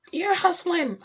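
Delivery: high, squeaky singled-out words; comic eavesdropper. Retold or imitated. Parent listening from other room, high comic confession. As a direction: pitch it way up, playfully sneaky.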